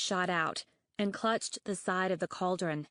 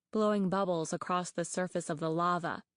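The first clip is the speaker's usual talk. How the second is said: usual speaking voice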